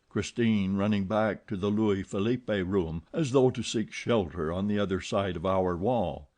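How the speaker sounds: usual speaking voice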